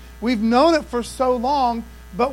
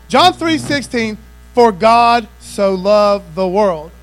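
loud and slow, every word weighted; slow booming pulpit reading; slow preached scripture; 8 dB louder, slower than usual. Acted. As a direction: lay it out slowly for the whole room.

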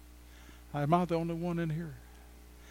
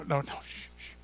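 playful hush; 5 dB quieter, much faster than usual. Pretend. Hushing himself about his age.

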